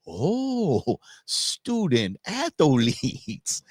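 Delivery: drawn-out mock Cartman catching on. Cartman repeats the euphemism back to the official. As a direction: stretch it out slowly, as if it finally clicks. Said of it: "And he said".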